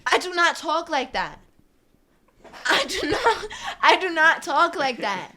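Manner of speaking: loud, high outburst breaking into laughter; laughing protest. Real, not acted. Speaker disowning her own sales pitch, laughing protest. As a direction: protest loudly through your own laughter.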